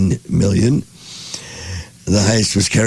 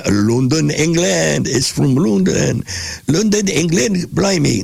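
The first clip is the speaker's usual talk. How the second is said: higher-pitched, badly faked British accent; 7 semitones higher than usual. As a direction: put on a hammy, unconvincing Cockney-style British accent. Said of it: "I don't have a good British accent".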